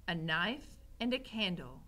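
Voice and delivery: ordinary delivery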